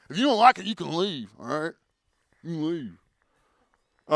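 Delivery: smug, dismissive official voice; mocking authority. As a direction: brush them off, slowly at the end.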